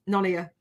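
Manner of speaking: "None of you" run together as "nunya"; casual contraction of "None of you"; slurred shorthand. Retold or imitated. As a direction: blur "None of you" into a two-syllable nunya. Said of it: "that's that short for".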